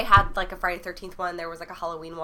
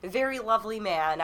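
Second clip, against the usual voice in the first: usual speaking voice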